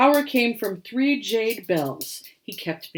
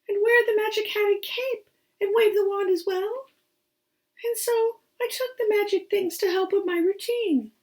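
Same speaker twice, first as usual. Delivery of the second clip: high little rabbit voice, sorrowful; the rabbit confessing with a sniffle and a sigh; sniffly rabbit; 12 semitones higher than usual. Acted. Rabbit tells his tale.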